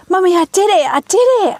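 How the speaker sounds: put-on high, thrilled little girl; excited child. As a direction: squeal it like a proud kid. Proud child after all-nighter.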